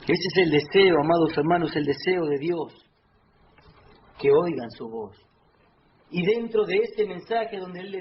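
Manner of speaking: prédica fuerte y grave; loud preaching. Acted. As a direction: drive home God's desire with full force. Preaching at full force.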